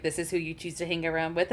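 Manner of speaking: quiet, quick disdainful aside; dry disdain. Acted. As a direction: mutter the judgment under the breath. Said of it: "He looks at Nigel and Marcus like".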